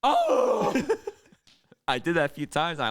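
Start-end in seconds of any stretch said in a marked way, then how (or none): 0.0-0.9 high, loud laughing howl; the beast unleashed, a gleeful howl. Retold or imitated. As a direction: throw out a wild whooping howl. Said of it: "I go".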